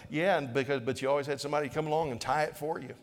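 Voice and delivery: normal talking voice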